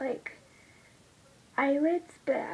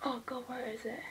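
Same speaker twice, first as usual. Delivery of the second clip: whisper; 8 dB quieter than usual. Real, not acted. Searching while doing makeup.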